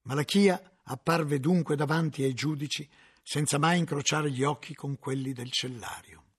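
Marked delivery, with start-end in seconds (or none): none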